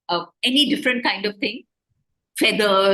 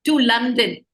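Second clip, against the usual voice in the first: excited; 5 semitones higher than usual. Retold or imitated. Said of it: "Or if you say".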